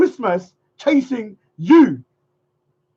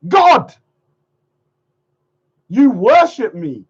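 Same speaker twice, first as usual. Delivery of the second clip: low, slow, booming proclamation; mock divine decree; mock god voice; 4 semitones lower, 3 dB louder, slower than usual. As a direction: pronounce yourself god.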